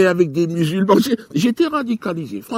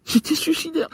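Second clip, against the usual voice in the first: mock crying; 9 semitones higher, 3 dB quieter than usual. Crying after giving the money.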